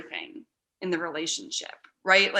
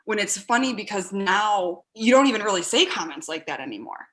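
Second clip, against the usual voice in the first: normal talking voice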